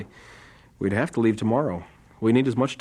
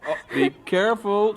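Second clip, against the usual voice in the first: laughing through the words; laughing warning; 8 semitones higher than usual. Acted. John laughing as he warns his pupil about Betty. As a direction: chuckle it out as a playful warning.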